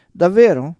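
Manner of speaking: loud, high mock-innocent question; playfully naive Davvero. A narrator's reading. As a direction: feign wide-eyed surprise, loudly. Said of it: "con aria ingenua".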